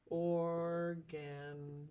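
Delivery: organize stretched very slowly by syllable; or, gan, ize, spaced apart; syllables split. Shown as a demonstration. Teacher splits organize for class, very slowly. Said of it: "how we would split the syllables".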